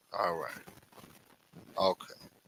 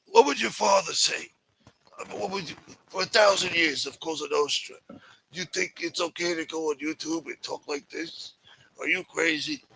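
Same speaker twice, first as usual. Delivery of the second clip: higher, scolding mob elder voice; Chris Colombo impression; 5 semitones higher than usual. Imitating Chris Colombo scolding Michael about Omerta, in a higher lecturing voice. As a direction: lecture him like a disappointed old mafioso. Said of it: "Chris Colombo was calling him".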